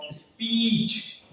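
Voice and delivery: स्पीच with a long stretched ee, slowly; long ee sound. Shown as a demonstration. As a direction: hold the ee in स्पीच. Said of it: "इस तरीके से".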